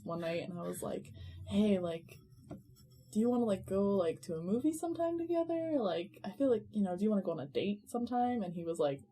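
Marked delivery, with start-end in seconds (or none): 1.5-8.4 soft, hesitant, halting invitation; tentative asking-out. Retold or imitated. Teen asking crush on a date at drop-off, tentative. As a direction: feel your way shyly toward the question.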